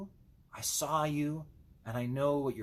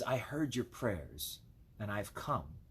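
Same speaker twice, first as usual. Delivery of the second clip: slow, soft and lower, full of gentle reassurance; tender Jesus; 6 semitones lower, 7 dB quieter, slower than usual. Acted. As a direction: speak it slowly and quietly, as a promise. Voicing Jesus answering prayer.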